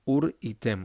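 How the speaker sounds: Ureitem with a small pause inside; pause in the word. Shown as a demonstration. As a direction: break Ureitem gently in two. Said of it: "A little bit of a pause there".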